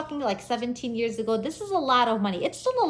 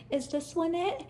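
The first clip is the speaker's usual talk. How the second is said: higher, softer unsure query; timid question; 7 semitones higher, 5 dB quieter than usual. Retold or imitated. Driver offering papers to an officer, timid question. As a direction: ask it meekly, voice lifting.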